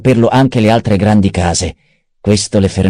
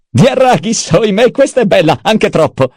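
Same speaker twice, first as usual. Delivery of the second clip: much higher, laughing through the words; mocking laughter; 12 semitones higher than usual. A narrator's reading. Peter mocking Leto's signature, laughing high. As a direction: cackle at the duke's title. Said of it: "Peter scoppiò a ridere".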